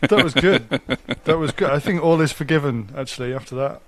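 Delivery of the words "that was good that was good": amused, laughing through the words; real chuckling approval. Real, not acted. Co-host laughs at faked interview.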